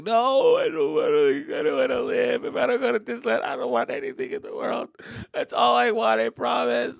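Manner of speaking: put-on high whiny begging child's voice; pleading kid. Son begging dad to go to Disneyland, high and whiny. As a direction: plead like a desperate little boy.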